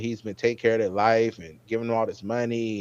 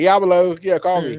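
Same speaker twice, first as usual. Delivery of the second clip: retold eager reply, higher and louder; eager yes; 6 semitones higher, 6 dB louder than usual. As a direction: blurt it out, too keen to hide it. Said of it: "So he's like".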